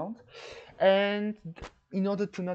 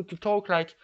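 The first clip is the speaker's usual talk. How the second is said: ordinary talk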